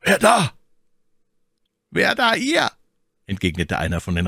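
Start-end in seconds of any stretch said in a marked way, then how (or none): 0.0-0.5 high, loud firm challenge into the dark; firm call. A narrator's reading. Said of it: "rief mit fester Stimme". 1.9-2.7 rider's challenge. A narrator's reading.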